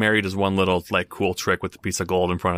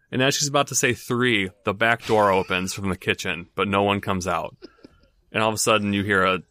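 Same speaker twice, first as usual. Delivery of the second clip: ordinary delivery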